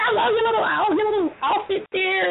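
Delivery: high, sugary compliment; gushing boss. Retold or imitated. CEO praising her little outfit, high sweet coo. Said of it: "she's like".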